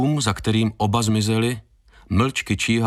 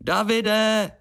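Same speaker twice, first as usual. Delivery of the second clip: high, carrying call; high-pitched, drawn-out holler; joining the shouting; 12 semitones higher than usual. A narrator's reading. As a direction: call out for a missing friend. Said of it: "přidala se Mia".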